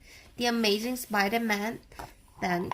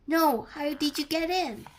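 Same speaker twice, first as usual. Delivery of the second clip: enraged; 7 semitones higher than usual. Acted. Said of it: "Mysterio turned enraged".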